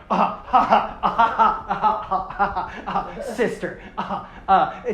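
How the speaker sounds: hammed-up haughty ha-ha laugh; mock villain laugh. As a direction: laugh at sister like a smug hero. Tahu's mocking laugh.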